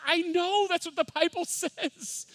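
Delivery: very high, squealed agreement; mock-exasperated outburst breaking into laughter; exclaimed retold reply.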